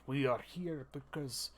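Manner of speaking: slow, lightly Russian kobold voice; a hint of a Russian accent, measured and slow; the GM voicing a kobold at the tavern, slow light Russian accent. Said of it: "I figured it'd be Russian".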